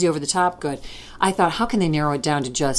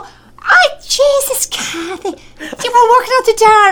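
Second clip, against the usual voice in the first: much higher, louder exclaiming granny in Scots; Scottish grandmother; 4 dB louder, slower than usual. Retold or imitated. Grandmother greets Kathy at the door, high loud Scots exclamation. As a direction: cry out like a shocked Scottish gran. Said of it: "And she'd go".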